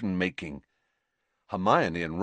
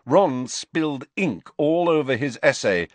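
plain reading voice

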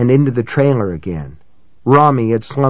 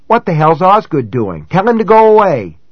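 higher, rising fake alarm; feigned surprise; 6 semitones higher, 4 dB louder than usual. A narrator's reading. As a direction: act startled and point. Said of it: "endeavored to look surprised".

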